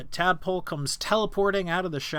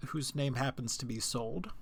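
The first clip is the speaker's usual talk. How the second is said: normal talking voice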